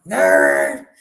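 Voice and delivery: deep raspy choked growl on The Raiders; low croaky cigarette voice, loud; Bourbon impression. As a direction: growl The Raiders like a chain smoker choking. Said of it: "you gotta say it like Bourbon".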